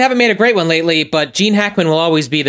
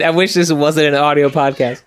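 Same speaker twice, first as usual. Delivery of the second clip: usual speaking voice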